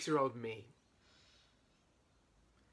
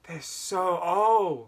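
very slow, higher mock-teen awe; teenage self; 5 semitones higher, 9 dB louder, much slower than usual. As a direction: stretch it out like an awed kid. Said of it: "would always look up ... and go".

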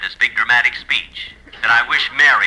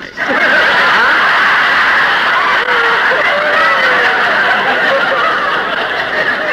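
big laugh; 5 dB louder than usual. Real, not acted. Audience roar.

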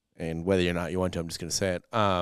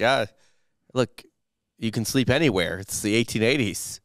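a bit higher, breezy brush-off; mock Arthur excuse; 5 semitones higher, 4 dB louder than usual. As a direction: wave it away like a shifty politician.

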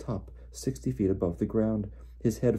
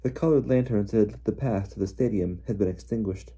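plain reading voice

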